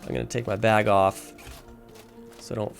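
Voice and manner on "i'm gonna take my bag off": normal talking voice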